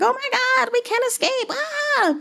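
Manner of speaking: very high mock panic; fleeing bandits. Bandits fleeing the crowd, very high mock panic. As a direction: squeal like a cornered bandit. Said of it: "they're like".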